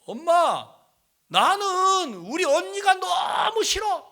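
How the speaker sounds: loud, high, impassioned confession; retold child's outburst. Sick child to mother about sister, loud resentful outburst. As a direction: imitate a child shouting out her resentment.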